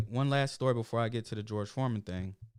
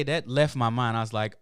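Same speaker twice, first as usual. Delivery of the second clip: ordinary talk, nothing marked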